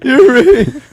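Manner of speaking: high, loud squeak through laughter; laughing falsetto mimicry; voice-crack imitation. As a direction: echo it in a mock cracking squeal. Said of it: "Did your voice just crack?".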